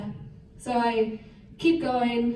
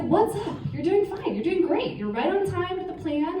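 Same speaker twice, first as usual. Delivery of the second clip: higher, lightly voiced coach reassuring; calm coach; 8 semitones higher than usual. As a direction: brush it off like an easygoing coach. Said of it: "he's like".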